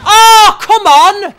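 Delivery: high, loud cry of frustration; indignant outburst; exasperated protest. Real, not acted. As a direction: yell in protest at an unfair game. Protesting at the game.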